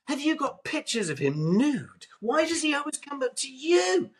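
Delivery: high, whiny mock of rival reporters; jealous journalists. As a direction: squeak it out like envious reporters. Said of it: "all the other journalists would always go".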